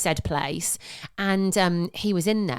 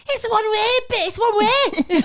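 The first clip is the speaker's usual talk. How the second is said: bad Scouse accent; 13 semitones higher, 5 dB louder than usual. Imitating a man calling from his car, bad Scouse accent. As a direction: botch a Scouse accent, pitched way up. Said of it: "I can't do a Scouse accent".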